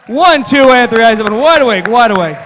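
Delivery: loud, ringing count to wake them. Acted. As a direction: snap them awake. Waking subjects loudly.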